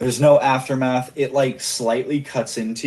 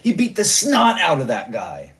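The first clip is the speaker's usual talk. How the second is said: higher, eager gushing agreement; eager henchman; 9 semitones higher than usual. Acted. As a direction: vouch for him excitedly. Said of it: "then this guy says".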